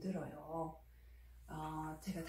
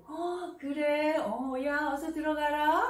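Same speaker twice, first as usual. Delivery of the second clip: high, louder happy yes; delighted response; 6 semitones higher, 5 dB louder, slower than usual. Shown as a demonstration. Mother delighted that her son goes straight to study, happy and louder. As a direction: sound truly pleased he chose study. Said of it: "기분 좋게 반응해 주시면 돼요".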